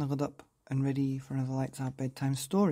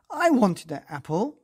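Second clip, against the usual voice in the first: angry shout, louder; 7 semitones higher, 8 dB louder than usual. Acted. Marvin shouting at Molly over the eaten apple, angry shout. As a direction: yell it like a cross little sheep. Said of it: "shouted Marvin".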